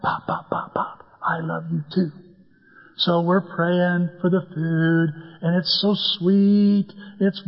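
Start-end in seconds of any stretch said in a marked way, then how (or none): 0.0-2.1 soft whisper, pop by pop; hushed squeeze back; whispered reply. Shown as a demonstration. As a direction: whisper it like a secret love note. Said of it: "squeeze their hand back".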